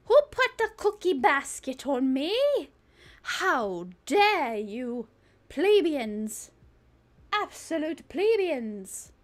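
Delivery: loud, haughty, drawn-out outrage; mock indignation. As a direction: scold the chat like offended royalty, hammed-up. Mock outrage at chat's prank.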